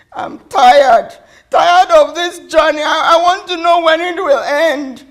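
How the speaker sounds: loud, high cry of weary protest; exasperated outcry. Acted. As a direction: cry it out, fed up to the limit. Child cries out in exasperation.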